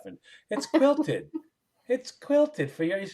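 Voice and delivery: quilted stretched out high and slow; mocking the fancy toilet paper, stressing quilted. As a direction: lean on quilted with fake awe.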